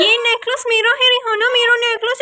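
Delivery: high, loud whining mimicry of sister; mock whiny claim. Big sister mimicking Rehana's loud whining over necklace. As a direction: whine it like a child grabbing. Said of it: "इतने जोर से बोल रही है".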